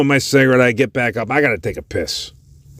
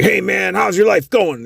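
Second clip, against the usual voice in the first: high, chummy question; friendly interviewer; 11 semitones higher than usual. Retold or imitated. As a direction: ask it like a cheery host. Crew asks homeless guy.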